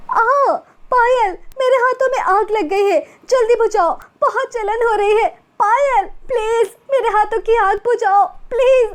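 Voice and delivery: put-on girl with burning hands begs Payal, crying out in pain; panicked pleading in pain. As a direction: beg for help as your hands burn. Hands on fire, begging help.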